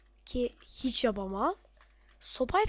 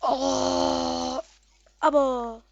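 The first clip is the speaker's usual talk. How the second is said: loud shocked cry; loud dismay; 6 dB louder, much slower than usual. Real, not acted. Player shocked by a sudden in-game death, loud cry. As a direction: let out a stunned yell.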